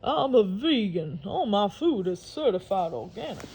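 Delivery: lightly voiced, reassuring alligator; calm the boy down, alligator.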